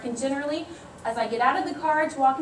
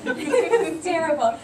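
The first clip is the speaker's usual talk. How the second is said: laughing; 4 semitones higher, 4 dB louder, slower than usual. Real, not acted. Laughing about poking son's eye.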